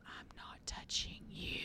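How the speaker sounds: barely voiced whispered taunt; silent mouthed taunt. To her brother behind dad's back, mouthed taunt.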